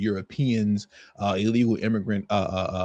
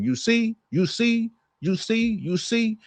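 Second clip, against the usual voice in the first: higher-pitched, imitating a Spanish accent on repeated you see; mock Spanish accent; 11 semitones higher, slower than usual. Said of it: "he couldn't get past saying".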